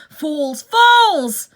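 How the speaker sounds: loud high furious shout; angry shout. Acted. Benjamin raging at the foolish animals, furious shout. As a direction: scold them at full voice. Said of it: "shouted Benjamin".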